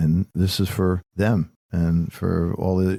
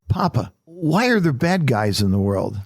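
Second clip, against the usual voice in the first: put-on higher, wondering child's question; curious little girl; 6 semitones higher than usual. Granddaughter asking Papa about bad guys, higher and wondering. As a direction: ask it wide-eyed like a small girl.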